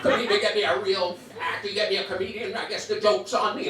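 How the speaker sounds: a hint of outraged diva; indignant old lady. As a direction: huff like an insulted veteran star. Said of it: "the lady just goes off".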